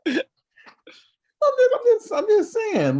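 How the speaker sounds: high, laughing squeak. Real, not acted. Laughing defense, high pitch.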